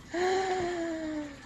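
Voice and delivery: long, high, near-tearful gasp; drawn-out gasp of overwhelmed delight. Real, not acted. Vlogger admiring her new cover, delighted gasp.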